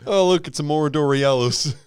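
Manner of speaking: put-on high, comic Fargo accent. Northerner points at the sky, Fargo accent. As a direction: marvel in a mock Fargo accent. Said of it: "That's Fargo".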